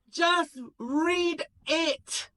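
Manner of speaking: high, loud, very slow command; high, drawn-out demand; impatient order. Acted. Michael orders.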